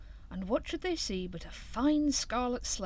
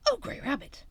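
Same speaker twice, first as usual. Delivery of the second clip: higher delighted exclaim; delighted cry; 9 semitones higher than usual. A narrator's reading. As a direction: gush over the cart. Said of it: "cried Squirrel".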